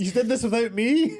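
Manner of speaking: mock hurt. Cohost voicing partner feeling left out, mock whine. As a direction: sound left out and wounded. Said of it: "feeling left out".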